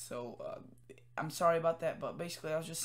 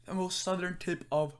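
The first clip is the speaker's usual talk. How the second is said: talking through a yawn; 4 dB louder than usual. Real, not acted. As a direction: yawn while saying it. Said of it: "Sorry about that yawning".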